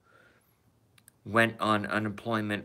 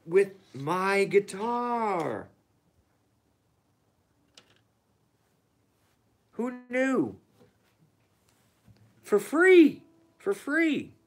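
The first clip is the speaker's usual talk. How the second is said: squeaky falsetto glee, much higher; giddy excitement; 10 semitones higher, 3 dB louder, slower than usual. Real, not acted. Excited streamer squealing to viewers about a free cover, high falsetto.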